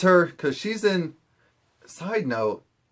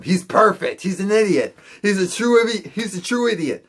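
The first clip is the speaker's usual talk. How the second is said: high, eager mock enthusiasm; high-pitched, insistent acted excitement; excited discovery; 6 semitones higher, 3 dB louder than usual. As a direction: gush as if you've found the one. Acted delight at finding their idiot.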